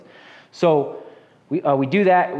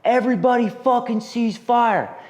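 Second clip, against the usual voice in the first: commander yelling cease fire; 10 semitones higher, slower than usual. Retold or imitated. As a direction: bark the order over the radio like a commander. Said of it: "I hear the commander come over the net".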